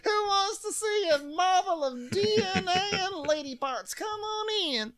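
very high hawker voice; pitched-way-up barker pitch; street barker. Retold or imitated. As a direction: lure passersby inside. Mock street barking.

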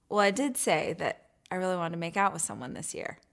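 deadpan, low and soft; stone cold. Retold or imitated. Woman bravely hinting to her date, stone cold. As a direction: say it with a straight face, all casual nerve. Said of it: "like, stone cold".

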